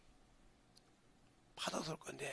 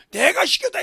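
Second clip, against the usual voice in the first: bold cry; 10 semitones higher, 16 dB louder than usual. Acted. Voices David.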